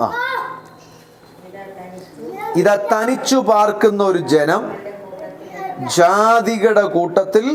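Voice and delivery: solemn scripture reading. Acted. Preacher reading the verse to the congregation, slow and solemn. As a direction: proclaim it slowly like a prophecy. Reads Numbers 23:9 aloud.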